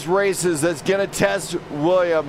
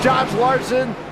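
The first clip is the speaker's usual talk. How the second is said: ordinary talk, nothing marked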